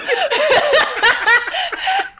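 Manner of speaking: high, loud burst of laughter; shrieking laugh at her own joke; big laugh. Real, not acted. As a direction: crack up out loud.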